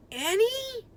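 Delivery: high anxious call; worried call. A narrator's reading. Jack calling for Annie after hearing her shriek, anxious. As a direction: call her name in fear.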